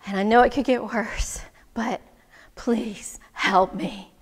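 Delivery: half-whispered begging, slowing at the end; hushed plea. Acted. Man begging wise woman for help, hushed. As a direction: sink into a worn-out plea.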